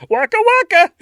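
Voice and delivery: high, loud Muppet bear cry; Fozzie Bear voice. Pretend. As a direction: bark it like the comic bear. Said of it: "A lot of Muppet characters".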